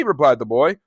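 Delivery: ordinary delivery, nothing marked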